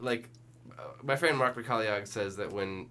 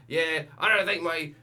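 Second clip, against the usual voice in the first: put-on retelling him in a light, raised Scottish accent; 6 semitones higher, 3 dB louder than usual. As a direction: mimic the Scottish chef with a laugh. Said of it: "This dude sounds so Scottish".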